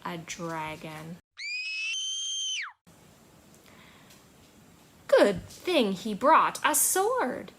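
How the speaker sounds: put-on reading to children. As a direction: read it gently to little listeners. Reads a child's story aloud.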